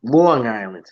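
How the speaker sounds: low, leaning on "Long" in "Long Island"; Long Island pronunciation. Shown as a demonstration. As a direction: coach the local "Long Island". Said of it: "There you go. You got it".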